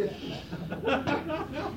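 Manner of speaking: breathy giggle at his own joke; amused chuckle; high airy laugh. Real, not acted. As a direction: laugh lightly under the breath.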